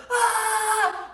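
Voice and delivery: short high scream of fright from a film; movie-style startled scream. Retold or imitated. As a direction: yelp like the guy in the Austin Powers scene. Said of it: "and the guy's like".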